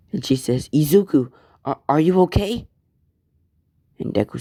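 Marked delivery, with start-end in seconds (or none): 0.7-2.7 higher, halting and anxious; higher worried voice of a mother hearing screams. Acted. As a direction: ask with a catch of alarm.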